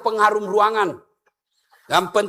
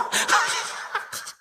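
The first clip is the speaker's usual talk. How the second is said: crowd laughter; 5 dB quieter than usual. Real, not acted. Audience laughter burst.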